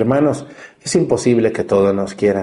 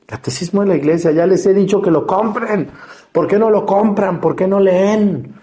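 much higher, fed-up rhetorical nagging; exasperated scolding; 8 semitones higher than usual. Real, not acted. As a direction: scold the flock like a frustrated teacher. Priest scolds congregation for not buying.

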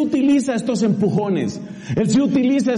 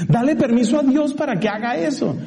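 ordinary talk

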